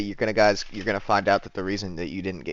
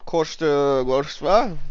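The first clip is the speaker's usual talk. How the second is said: fake German; 4 semitones higher, much slower than usual. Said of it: "in just fake German".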